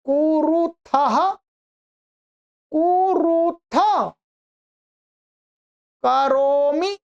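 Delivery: drawn-out, slow chant of verb forms; teacher reciting paradigm slowly; slow recitation. Shown as a demonstration. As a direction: recite each form slowly for the class to repeat. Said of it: "teacher recites conjugation slowly".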